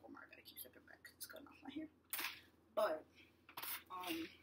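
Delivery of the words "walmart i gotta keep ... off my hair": hushed fast whisper. Retold or imitated. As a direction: whisper it under your breath, quickly. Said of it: "I was like".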